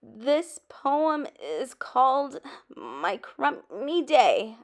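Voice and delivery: slow, nervous, voice cracking on the title; a shaky start before the class; lightly voiced nervous crack. A narrator's reading. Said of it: "my voice cracking".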